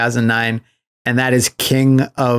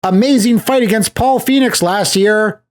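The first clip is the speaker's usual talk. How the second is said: high, breathless hammed-up hype voice; mock movie line; 9 semitones higher than usual. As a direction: parody a gushing film character. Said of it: "They're like".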